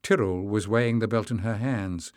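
plain reading voice

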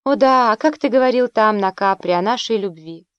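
plain reading voice

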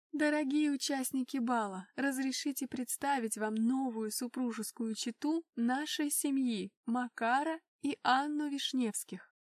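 plain reading voice

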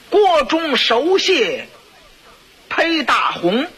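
slow, high, declaimed line of verse; reciting the couplet. Shown as a demonstration. Chancellor reads the insulting couplet aloud, slow and high. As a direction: intone the couplet slowly, savouring each word. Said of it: "你听听".

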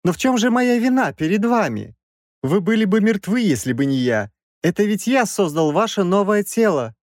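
high-pitched, agitated self-defence; pleading professor. A narrator's reading. Professor pleads for his life.

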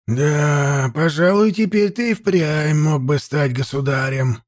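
lightly voiced wise old man; old priest voice. Old priest Nevid speaks.

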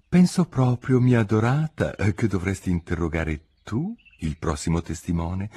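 plain reading voice

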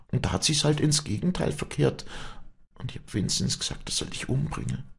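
high, impassioned confession in a woman's voice; anguished high-pitched outpouring; distraught Wally. Acted.

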